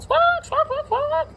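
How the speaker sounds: high squawking chicken noises; chicken squawks. Acted. Teacher voicing chickens for kids, high squawks. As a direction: squawk it like a startled hen.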